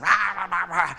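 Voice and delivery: loud wordless ranting imitation; mock cussing. Imitating a cussing coworker, loud mock rant. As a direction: growl out gibberish in place of swearing. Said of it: "somebody be cussing".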